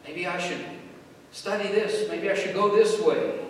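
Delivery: high-pitched acted enthusiasm, pushing up in volume; eager speculation. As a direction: act out talking yourself into a new plan. Voices believer weighing options.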